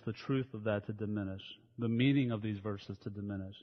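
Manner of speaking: soft, reverent pastor's prayer; hushed, earnest pulpit prayer; opening prayer. Acted. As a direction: pray quietly over the congregation. Opening prayer before the sermon.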